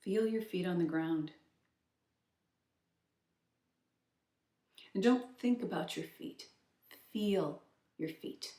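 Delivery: soft, unhurried meditation voice with long pauses; softly led grounding exercise; guided meditation. Acted. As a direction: gently guide the listener's attention inward, leaving space between instructions.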